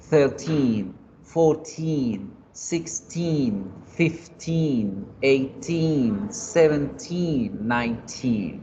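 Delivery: put-on each number with a drawn-out final -teen, as in "Thirteen"; stressed long TEEN. Shown as a demonstration. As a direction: list them leaning on the last syllable, "fourteen", "sixteen". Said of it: "yo alargo la I al finalizar cada uno". (cada número alargando la i de -teen, como "nineteen")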